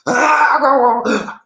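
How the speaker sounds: loud, high fake bawl; mock baby crying. Leader crying before his people, mock baby bawl. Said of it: "crying like a baby".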